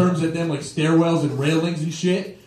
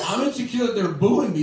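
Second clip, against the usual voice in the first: ordinary delivery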